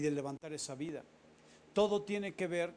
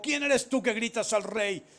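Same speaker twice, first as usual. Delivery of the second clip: 1 angry reply; 4 semitones higher, 8 dB louder than usual. Acted. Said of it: "Abner respondió y dijo".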